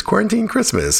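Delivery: imitating a toddler's proud announcement; little daughter's voice. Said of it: "she goes".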